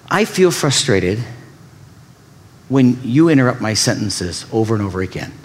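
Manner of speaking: gently name the feeling; calm right way. Shown as a demonstration. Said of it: "Here's the right way to say it".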